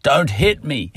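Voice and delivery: hammed-up frightened dog voice; voicing a wary dog. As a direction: yelp it as the dog would. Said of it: "when the dog comes up to me".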